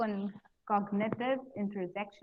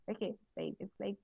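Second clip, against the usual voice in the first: quiet aside; 7 dB quieter than usual. Shown as a demonstration. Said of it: "you don't use that word".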